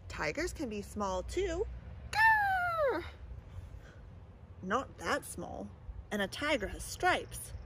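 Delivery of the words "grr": tiny high mock growl; a mouse trying to roar; high little mock roar; squeaky growl. Mouse tries a tiger growl.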